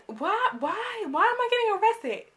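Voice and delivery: very high wailing plea; loud, high-pitched mock wail; tearful protest. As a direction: act out pleading through tears.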